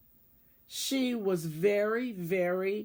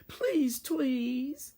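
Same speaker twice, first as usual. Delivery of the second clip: pleading cry; 7 semitones higher than usual. A narrator's reading. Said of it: "Then all of the mice cried".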